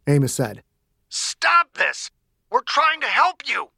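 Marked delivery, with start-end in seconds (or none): 1.1-3.7 high, strained appeal; exasperated, raised-pitch plea; pleading doctor. Acted. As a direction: urge a screaming patient to stop. Doctor pleads with screaming girl.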